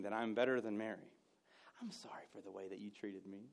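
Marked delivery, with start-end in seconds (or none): 1.8-3.5 sarcastic apology whispered with a smirk; smug whispered non-apology. Shown as a demonstration. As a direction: hiss the fake apology, smug. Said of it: "get that smirk just smacked off my face".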